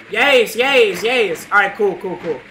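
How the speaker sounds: ordinary talk, nothing marked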